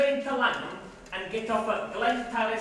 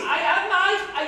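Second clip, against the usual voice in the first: slow, higher laughing admission; 7 semitones higher, 7 dB louder, slower than usual. Acted. Comedian laughing as he admits it, slow chuckling. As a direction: chuckle through it.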